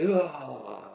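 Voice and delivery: a reluctant groan of annoyance; annoyed groan. Retold or imitated. Husband to wife about the call, a reluctant ugh. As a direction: groan like a chore was handed to you.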